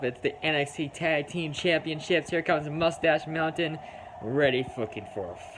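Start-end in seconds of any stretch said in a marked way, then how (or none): none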